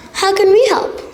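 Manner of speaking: ordinary delivery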